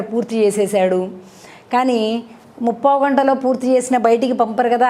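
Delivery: plain reading voice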